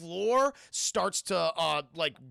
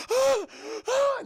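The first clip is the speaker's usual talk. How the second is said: high, loud gasping yelps; two frantic high-pitched screams; imitated terrified cries; 8 dB louder than usual. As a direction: cry out in mock panic. Acting Sarah fleeing in terror.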